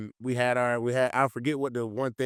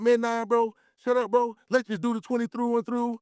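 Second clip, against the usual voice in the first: mock heated teammate; 9 semitones higher than usual. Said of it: "he was like".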